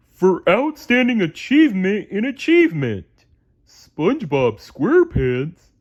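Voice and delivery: high, loud dopey starfish reading an award; proudly reading out a trophy; reading aloud in Patrick voice. A narrator's reading. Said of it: "read aloud".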